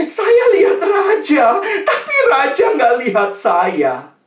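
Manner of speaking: slow, high, loud wail; mock sobbing child. Pupil reveals why she weeps, loud mock wailing. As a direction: bawl it out like a heartbroken child. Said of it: "dia nangis terseduh-seduh".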